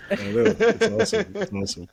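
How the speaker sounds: squeaky high mock commercial voice; mock ad falsetto. Guest joins the gum joke in a high mock ad voice. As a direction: pipe it up high like a cheesy ad.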